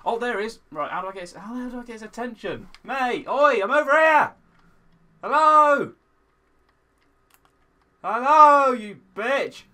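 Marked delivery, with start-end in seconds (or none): 2.8-4.3 loud high call; yelling to lure the ghast. Real, not acted. Calling a ghast to attack. 5.2-5.9 loud high hello; shouting to the ghast; calling out. Real, not acted. 8.0-9.6 loud higher taunt; goading the ghast; taunting shout. Real, not acted.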